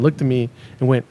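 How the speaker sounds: ordinary delivery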